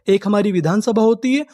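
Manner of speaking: ordinary talk, nothing marked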